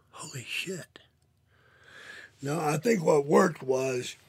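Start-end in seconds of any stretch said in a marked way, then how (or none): 0.1-1.0 a breathy, unvoiced holy shit; awed whisper. Real, not acted. Marveling that getting clean worked, a soft awed whisper. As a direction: whisper it in disbelief.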